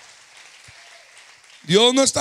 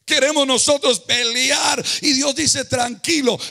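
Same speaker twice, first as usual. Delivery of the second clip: loud, higher, rising pulpit cry; full-force preaching; 3 semitones higher, 4 dB louder than usual. Acted. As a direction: drive the message home.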